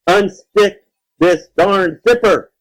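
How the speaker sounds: high, loud, slow punched-out demand; scream. A narrator's reading. Man stuck in monster costume, screaming slowly. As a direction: scream each word separately in frustration. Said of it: "he screamed".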